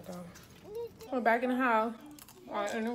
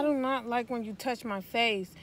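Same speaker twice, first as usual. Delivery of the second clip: angry dressing down; 2 semitones higher, 7 dB louder than usual. Real, not acted. Mother scolds child for face.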